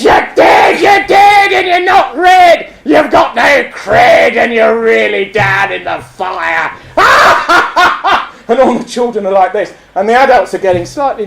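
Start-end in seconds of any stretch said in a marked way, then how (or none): 0.0-6.7 high, slow puppet voice; drawn-out puppet taunt, falling at the end; gloating Punch. Acted. Punch gloating over dead beadle. 7.0-8.2 high staccato mad cackle; chilling high Punch laugh; villainous puppet cackle. Acted. Punch's laugh after gloating.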